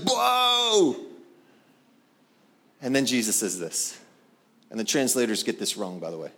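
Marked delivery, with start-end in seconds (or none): 0.1-0.9 high, drawn-out mock amazement; hammed-up astonished exclamation; astonished disciple. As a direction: stretch it out high in acted awe. Acts out Peter's astonishment.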